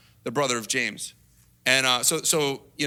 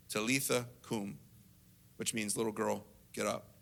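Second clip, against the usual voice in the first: gentle bidding; 7 semitones lower, 11 dB quieter than usual. Retold or imitated. Jesus holding the dead girl's hand, gentle bidding. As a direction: bid her softly in a lowered voice.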